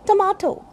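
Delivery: tomato with a long ah, tuh-MAH-toh; correct pronunciation. Shown as a demonstration. Teacher modelling tomato for learners, tuh-MAH-toh. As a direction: open the middle of tomato to ah. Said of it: "a sound is there".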